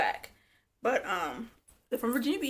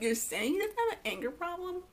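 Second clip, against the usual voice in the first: higher, disbelieving question; incredulous; 8 semitones higher than usual. Retold or imitated. Retelling her own disbelief at the reunion. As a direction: question her like it's absurd. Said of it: "I was like".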